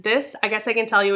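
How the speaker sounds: ordinary talk, nothing marked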